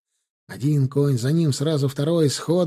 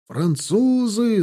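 high, stretched cry of alarm; yelling in alarm; 8 semitones higher, much slower than usual. A narrator's reading. Several soldiers seeing the French, alarmed yell. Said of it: "завопило несколько глоток".

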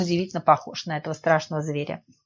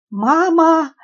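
high, loud yelp of fright; frightened cry; 10 semitones higher, 9 dB louder than usual. A narrator's reading. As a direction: cry out at sudden danger, voice leaping up. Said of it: "вскрикнула девица в чёрном". (испуганный высокий вскрик)

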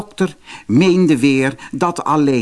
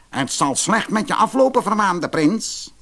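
high, slowing curse from the dwarf; dwarf's curse; 8 semitones higher than usual. Acted. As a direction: lay the curse on him deliberately.

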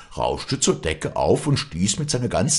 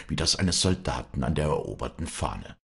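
ordinary delivery, nothing marked

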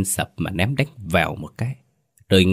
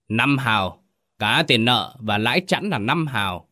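contemptuous village chief, pitched higher, impatient; scornful tyrant chief; 3 semitones higher than usual. Acted. Village chief dismissing the widow, scornful and higher. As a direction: brush off the begging with disdain. Lão Kính voiced, scornful.

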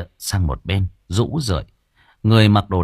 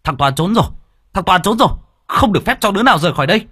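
higher, louder, fast shout; shouting alarm; 9 semitones higher, 4 dB louder, much faster than usual. A narrator's reading. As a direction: yell the alarm. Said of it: "kêu lớn".

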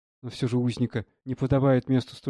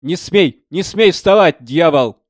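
full-force, high alarmed yell; loud shout; 5 semitones higher, 13 dB louder, slower than usual. A narrator's reading. Young artist orders the devil not to get up, shouting loudly. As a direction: shout him down with all your force. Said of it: "он громко закричал на меня".

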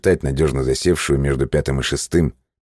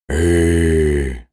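drawn-out sighing groan; a heavy creature sigh of agreement; penguin sighing groan. Acted. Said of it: "соглашается пингвин, тяжело вздыхая".

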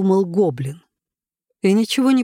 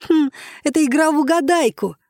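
put-on high girlish squeal; 9 semitones higher than usual. A narrator's reading. Giggly girl squealing her guess at Jenkins, high-pitched. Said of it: "взвизгнула смешливая девочка".

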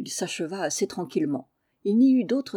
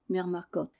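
usual speaking voice

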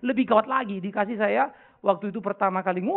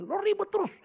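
retold quarrel; 12 semitones higher than usual. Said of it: "saya kalau ribut sama istri saya".

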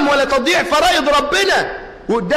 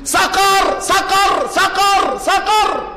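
high mock cry of the dying young man; repeated high wail; retold scream; 8 semitones higher, much slower than usual. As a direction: cry out the word in terror, lightly acted. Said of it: "يصرخ ويقول".

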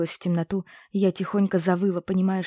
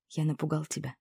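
quietly; 2 semitones lower, 7 dB quieter than usual. A narrator's reading. Ryan asks the rescued girl softly if he scared her. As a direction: ask her softly, carefully. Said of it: "тихо спросил Райан".

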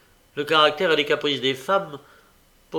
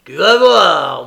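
loud, drawn-out menace through gritted teeth; furious threat; 4 semitones higher, 15 dB louder, slower than usual. A narrator's reading. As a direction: threaten loudly, stretching the words. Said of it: "dit Sykes en grinçant des dents".

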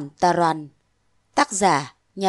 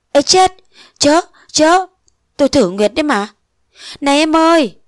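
much higher and louder alarm; panicked shout; 7 semitones higher, 6 dB louder than usual. Acted. Phong grabbing Nguyệt at the bridge, panicked shout. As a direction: stop her in panic.

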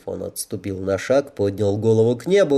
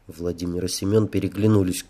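usual speaking voice

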